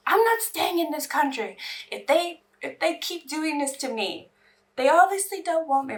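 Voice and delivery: retold indignant rant, pitched high; indignant outburst. Telling viewers what she said about leaving the country, indignant. Acting out her own upset rant.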